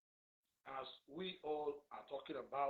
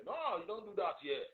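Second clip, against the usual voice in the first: angry rebuke; 6 semitones higher, 10 dB louder than usual. Real, not acted. Rebuking commenter again.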